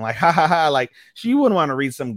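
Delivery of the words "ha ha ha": loud, high mock "ha ha ha"; imitated laughter. Imitating the girl next to Yamada, mock laughing loudly. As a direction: imitate someone bursting out laughing. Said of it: "starts laughing".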